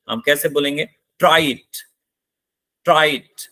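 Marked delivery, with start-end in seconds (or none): 1.2-3.5 "Try it" with TRY falling and "it" rising up at the end; fall-rise on try it. Shown as a demonstration. Teacher demonstrating a command with one important word, fall-rise tone. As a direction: drop the voice on "try", lift it on "it". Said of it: "तो try में आवाज down हुई, इट में आते आते आवाज ऊपर हो गई".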